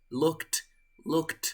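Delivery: each "looked" one syllable, lookt. Shown as a demonstration. Teacher drilling the correct past tense, "looked" as lookt. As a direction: release a clean T after the K in "looked". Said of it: "entonces suena totalmente".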